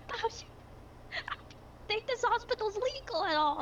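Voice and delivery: high, panicky robot worry; frantic high-pitched fretting. Acted. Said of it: "they immediately go".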